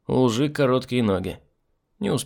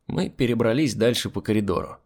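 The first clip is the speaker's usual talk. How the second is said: usual speaking voice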